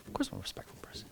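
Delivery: high, airy whisper of a cocky answer; mock smug student. Student answering the rabbi, mock smug whisper. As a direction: breeze it off like it's obvious.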